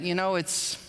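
ordinary delivery

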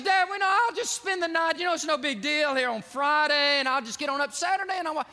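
high, loud, frightened begging; fearful pleading. Retold or imitated. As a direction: beg your dad not to make you go. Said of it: "He's fearful".

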